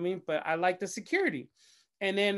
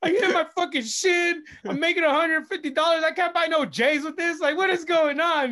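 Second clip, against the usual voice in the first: higher and louder, shouting through laughing tears; frustrated rant; 8 semitones higher, 6 dB louder than usual. Retold or imitated. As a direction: rant in the car, half laughing, half crying. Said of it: "and I'm just like".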